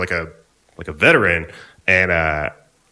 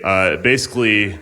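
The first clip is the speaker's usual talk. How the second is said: usual speaking voice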